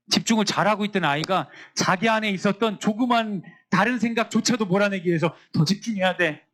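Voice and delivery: usual speaking voice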